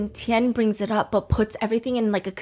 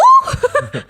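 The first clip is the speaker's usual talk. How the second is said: loud high laugh; 10 dB louder than usual. Real, not acted. Laughing hard at her own impression of Zizi. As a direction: burst of high shrieking laughter.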